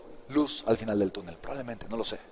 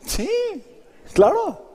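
high, loud mock "claro"; sarcastic claro; 3 dB louder, much slower than usual. Real, not acted. Speaker mocking the idea of giving it away, sarcastic. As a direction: toss off a scornful "claro". Sarcastic aside after quoted price.

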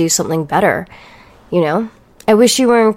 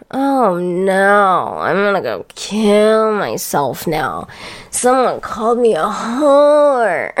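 mock crying; slower than usual. Mocking the commenters, faking hurt tears. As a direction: pretend to be wounded, overdone and sarcastic.

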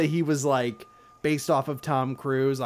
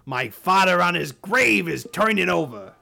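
mock outraged crewman; 5 semitones higher, 7 dB louder than usual. Crew member mock outrage.

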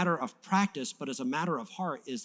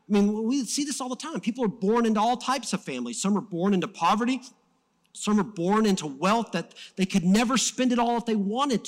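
raised, urgent pulpit voice; impassioned preaching; 7 semitones higher, 5 dB louder than usual. Real, not acted. Preacher telling the church about birth and wealth, impassioned. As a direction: drive the point home to the congregation.

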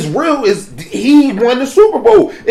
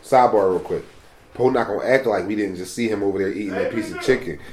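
much lower and softer, a quick hushed aside; hushed sidebar; 11 semitones lower, 10 dB quieter than usual. Real, not acted. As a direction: drop your voice so the eater won't hear. Sidebar about someone eating nearby.

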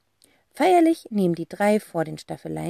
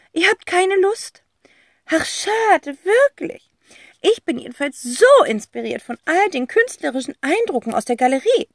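high, theatrical aunt, dramatic and let down; eccentric aunt voice; 10 semitones higher, 5 dB louder than usual. Acted. Aunt gushing to the kids about the gallery, theatrical high voice. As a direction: play the gushing artsy aunt, higher pitched. Tante Rotz figure voice.

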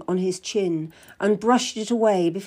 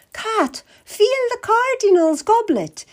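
much higher, slow and sugary; sweet scheming queen; 8 semitones higher, 4 dB louder than usual. Acted. Queen to Kath, baiting the Cardinal, much higher and sugary. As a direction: sweetly plant a worry in his ear.

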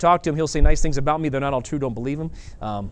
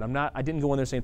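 ordinary talk, nothing marked

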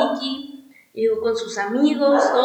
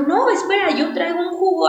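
eager offer; 6 semitones higher than usual. Retold or imitated. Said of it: "él me dijo".

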